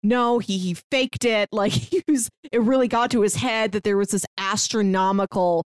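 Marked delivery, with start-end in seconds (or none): none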